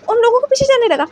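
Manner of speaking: ordinary delivery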